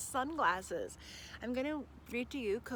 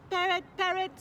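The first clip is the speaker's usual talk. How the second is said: robot voice; 8 semitones higher, 7 dB louder than usual. Acted. Robot repeats word.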